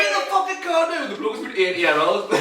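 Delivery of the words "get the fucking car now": pitched-up laughing mock order; barking at the old man in retelling; mock furious order. Telling the old driver to move, high mock order. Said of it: "I was like".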